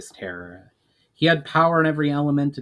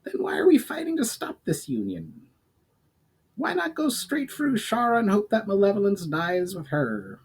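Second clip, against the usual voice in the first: higher goblin, curious and probing; 9 semitones higher than usual. Acted. Goblin questioning the elf about the elementals, higher voice. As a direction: pitch up and question eagerly.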